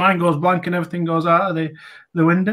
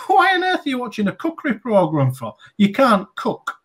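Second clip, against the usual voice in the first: higher teasing jab; mocking jab; 8 semitones higher than usual. Husband teasing his wife in the kitchen, higher mock jab. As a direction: needle her about her cooking. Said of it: "I said to her".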